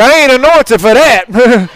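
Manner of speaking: fed-up mock complaint about babysitting; exasperated quip. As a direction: throw up your hands, done with the kids. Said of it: "And I was like".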